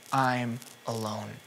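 slow, soft, broken I'm alone; quiet anguished lament; grieving cry. Acted. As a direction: let it sink low and slow, almost breaking. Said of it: "He's crying".

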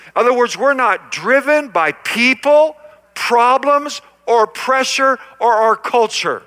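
loud, high, driving preacher cadence; preaching at full force. Acted. Pastor rousing the congregation, full-force preaching. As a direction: pound each phrase to the crowd.

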